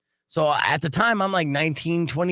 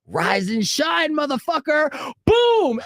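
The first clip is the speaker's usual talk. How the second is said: slow high call climbing to a loud boom; spring the prank, drawn out then exploding; imitated triumphant shout, building high; gleeful mock wake-up call; 14 semitones higher, 4 dB louder, slower than usual. Acting out springing the hose prank.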